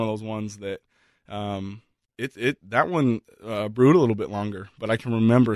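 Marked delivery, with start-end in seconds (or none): none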